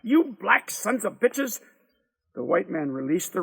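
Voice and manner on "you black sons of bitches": high, slow, drawn-out insult; furious curse. A narrator's reading. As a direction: spit out each word in rage. Attacked man curses both boys.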